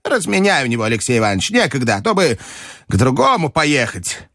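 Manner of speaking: high and cross, then settling; old lady's irritable outburst; shouting grandmother. A narrator's reading. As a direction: start indignant, ease down into orders. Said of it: "крикнула бабушка".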